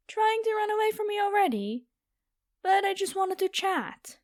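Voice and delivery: high, sing-song mock-innocent taunt; mock innocence. Vampire teasing the fleeing human, high mocking taunt. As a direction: pretend you only want a chat.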